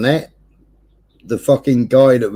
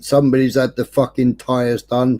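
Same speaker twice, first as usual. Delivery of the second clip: ordinary talk, nothing marked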